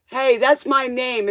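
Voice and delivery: normal talking voice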